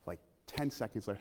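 ordinary talk, nothing marked

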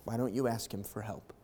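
ordinary talk

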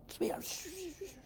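put-on whispered shushing to a child; hushing whisper. Parent hushing an overeager child at the table, whispered. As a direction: hush the kid under your breath.

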